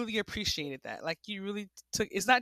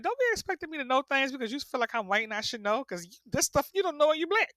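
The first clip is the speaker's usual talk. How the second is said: mock exasperation; 7 semitones higher than usual. Retold husband scolds about expectations.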